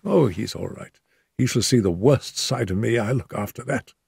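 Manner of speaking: injured Holmes. A narrator's reading. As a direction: speak up from the pillow, hurt but calm.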